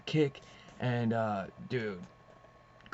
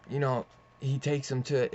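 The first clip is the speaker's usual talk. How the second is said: usual speaking voice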